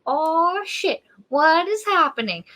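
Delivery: high, drawn-out disbelief; mock astonishment. Friends reacting to talking trees, mock astonished.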